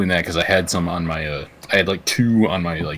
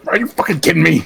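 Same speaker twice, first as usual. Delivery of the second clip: high-pitched exasperated newspaper ranter; mock outrage; 9 semitones higher, 4 dB louder, faster than usual. Bus rider reading a newspaper, mock outraged disbelief. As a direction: erupt in hammed-up disbelief. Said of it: "you're reading a newspaper going".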